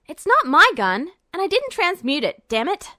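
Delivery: loud, indignant snap back; heated retort. Acted. Edward retorting to the general, loud and indignant. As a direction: fire it back at him. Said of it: "Edward retorted".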